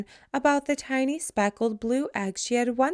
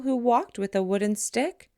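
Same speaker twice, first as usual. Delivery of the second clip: usual speaking voice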